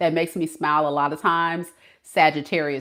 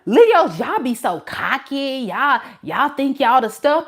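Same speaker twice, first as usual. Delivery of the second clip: mocking critic; 8 semitones higher, 5 dB louder than usual. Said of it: "When people say".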